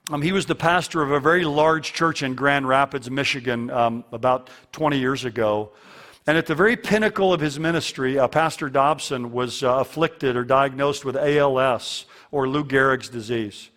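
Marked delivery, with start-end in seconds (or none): none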